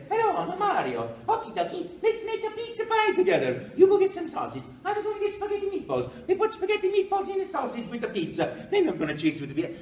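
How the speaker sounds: very high, loud cartoon Italian voice; sing-song little Italian plumber bursting with glee; Mario. Actor auditioning for the camera, high gleeful Italian cartoon voice. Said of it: "I look at the camera and I say".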